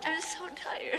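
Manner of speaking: breathy tired whisper; whispered exhaustion. Acted. Exhausted patient whispering to her mother, spent. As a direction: barely whisper it, drained.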